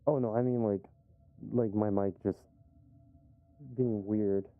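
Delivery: fuzzy, distorted mic sound. Real, not acted. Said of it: "Why do I sound so distorted?".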